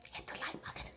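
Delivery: hushed, insistent whisper. Real, not acted. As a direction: whisper it like a secret nudge. No, seriously, whispered repeat.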